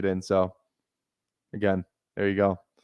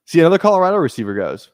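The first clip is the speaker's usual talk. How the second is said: louder and higher, a frustrated burst; excited outburst; 7 semitones higher, 7 dB louder than usual. Real, not acted. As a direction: blurt it out as the pick goes. A receiver he wanted gets drafted.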